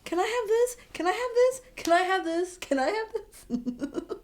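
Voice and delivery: swooning fan pleading in a squeaky high voice; squealing want. Pretend. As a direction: beg for him like a kid at a toy store. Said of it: "And I'm just like".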